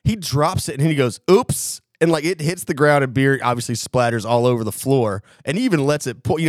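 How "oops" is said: mock-innocent oops, pitched high; fake accident. Friend faking an accidental beer drop, mock innocent. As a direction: imitate a badly faked slip. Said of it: "he did, like, the fake drop".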